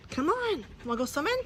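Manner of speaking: high, soft coaxing call; soft high pet voice; coaxing the dog. Real, not acted.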